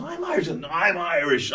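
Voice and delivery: very high, insistent squawk; mock protest. Film star insisting to his co-star, high-pitched mock protest.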